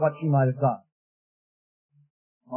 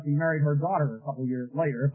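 ordinary talk, nothing marked

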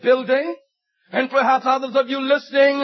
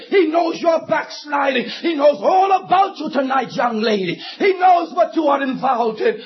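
impassioned preaching; 4 semitones higher than usual. Real, not acted. Preacher telling young listeners God knows them, impassioned preaching. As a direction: press each phrase home, then peak.

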